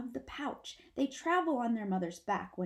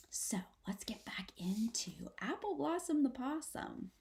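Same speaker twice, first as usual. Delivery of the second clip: whisper; 3 semitones higher, 4 dB quieter than usual. Real, not acted. Teacher whispering to her class, hushed whisper. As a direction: keep it all in a hushed whisper.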